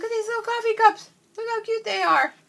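high, loud excited squeal; high-pitched thrilled cooing; delighted squeal. Real, not acted. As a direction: burst out gushing over something tiny and cute. Gushing over tiny coffee cups.

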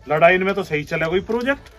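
usual speaking voice

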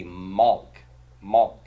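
Malk said as a single syllable; one-syllable Malk. Shown as a demonstration. Teacher showing the old word, Malk in one syllable. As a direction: run the L into the K in Malk. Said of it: "It was a one-syllable word".

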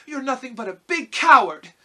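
put-on high, loud girl's scolding; scornful retort. A narrator's reading. Dorothy calls out the cowardly lion, high angry scolding. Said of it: "she retorted".

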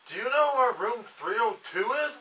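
lightly voiced shy new student; new kid voice. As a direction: play the lost new kid asking the way. New kid asking for a room.

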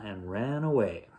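plain reading voice

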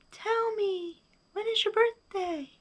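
high, soft echoed question; faint high-pitched reply. A narrator's reading. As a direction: repeat it like a distant echo. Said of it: "his own voice echoed".

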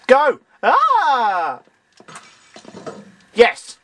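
loud, high triumphant yells; excited shouts. Real, not acted. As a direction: cheer the win. Gaming cheers.

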